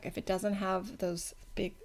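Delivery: speaking through hiccups. Real, not acted. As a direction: hiccups breaking the words. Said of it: "I have the hiccups".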